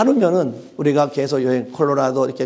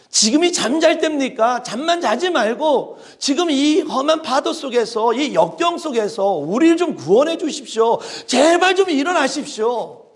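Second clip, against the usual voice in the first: high, urgent begging over the storm; panicked disciple pleading; frightened pleading; 9 semitones higher than usual. Acted. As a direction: beg him to wake, half laughing at the absurdity. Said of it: "흔들면서 깨웁니다".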